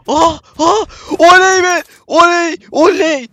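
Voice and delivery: high, loud whoops of joy; victory yells at the screen; ecstatic cheering. Real, not acted. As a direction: shout it out, over the moon. Catches rare Argent, celebrating.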